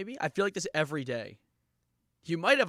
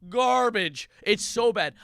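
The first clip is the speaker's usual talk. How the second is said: louder, disgusted rant; emphatic rant; 4 semitones higher, 6 dB louder, slower than usual. Real, not acted. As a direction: spit out the disgust. Ranting about diet soda.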